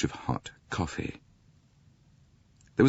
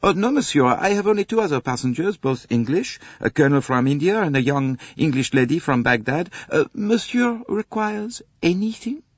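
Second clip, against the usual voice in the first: high, louder French conductor voice; eager to please the passenger; 11 semitones higher, 4 dB louder than usual. A narrator's reading. Conductor answering Poirot.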